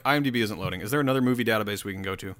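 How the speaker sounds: usual speaking voice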